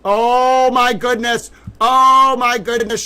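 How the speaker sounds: high, loud delighted gasps; overjoyed at what he sees; thrilled. Real, not acted. Reacting to the Globe front page, delighted and loud. First look at the story.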